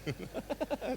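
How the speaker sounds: soft giggle going up; high quiet giggle. Real, not acted. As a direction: let a small giggle slip out. Laughs at own joke.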